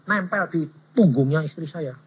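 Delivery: ordinary talk, nothing marked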